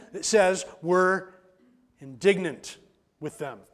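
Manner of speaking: ordinary talk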